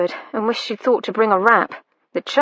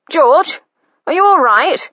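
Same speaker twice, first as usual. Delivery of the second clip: higher, louder call through a door; 6 semitones higher, 6 dB louder than usual. Acted. Brother calling through door.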